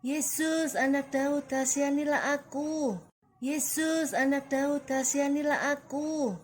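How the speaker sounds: high, loud, held-out calling; crying out. A narrator's reading. Bartimius begs Jesus for mercy, crying out. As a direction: call out insistently, almost chanted on one high note. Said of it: "Bartimius berteriak".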